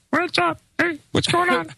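high, soft, put-on schmoozing politician greeting; co-host mocks a politician with a high, soft fake hello; comprehensive voice. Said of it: "That sounds like your comprehension voice".

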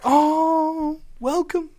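high, sing-song welcome; mock friendly welcome. Airport staff greeting a New Zealand passport, high and sweet. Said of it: "they're like".

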